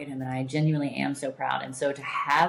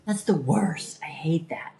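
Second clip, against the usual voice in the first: whisper; 3 dB louder than usual. Real, not acted. Sympathizing with overstimulated friend.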